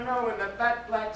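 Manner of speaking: plain reading voice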